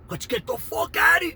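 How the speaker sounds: high, loud call to flee; urgent yell. Retold or imitated. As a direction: yell it to get everyone moving. Shouting to flee the fight.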